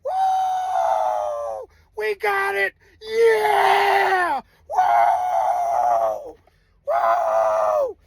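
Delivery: high whooping mock cheer; imitating police cheering. Police celebrating the find, high whooping cheer.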